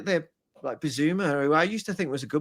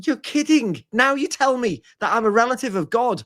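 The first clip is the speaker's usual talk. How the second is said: amazed; 7 semitones higher than usual. Retold or imitated. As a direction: blurt it out thrilled and stunned. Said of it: "I was like".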